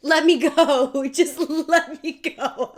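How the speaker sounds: loud, slow, overblown farewell plea; mock tragic let me go; dramatic Titanic plea. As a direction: ham up a tragic movie goodbye. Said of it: "Jack on Titanic".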